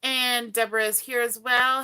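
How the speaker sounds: normal talking voice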